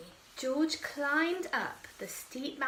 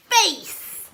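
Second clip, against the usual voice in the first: ordinary delivery, nothing marked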